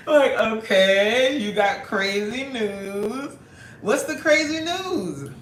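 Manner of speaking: loud, slow, laughing tease. Real, not acted. Host teasing a viewer about his crazy news, laughing loudly. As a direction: egg him on through laughter.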